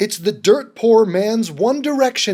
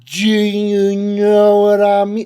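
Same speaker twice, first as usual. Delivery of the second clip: "Do you know what I mean" stretched out very slowly; exaggerated slow-motion catchphrase; slowed right down; 3 dB louder, slower than usual. Shown as a demonstration. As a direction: drag every word out in mock slow motion. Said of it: "slow that down for you".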